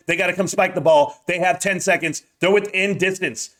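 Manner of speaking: ordinary talk, nothing marked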